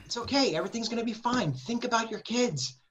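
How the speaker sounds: calm the patient down softly; soothing nurse. Retold or imitated. Nurse comforting the crying patient, gently reassuring. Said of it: "the nurse goes".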